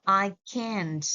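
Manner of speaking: can't with a crisp t sound at the end; can't, full vowel, t audible; clear t in can't. Shown as a demonstration. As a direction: say can't and land the final t. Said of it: "with the t sound at the end".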